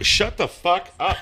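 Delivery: normal talking voice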